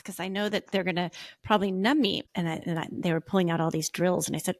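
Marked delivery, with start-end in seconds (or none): none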